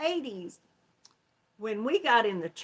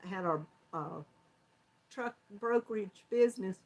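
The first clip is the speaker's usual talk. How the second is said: ordinary talk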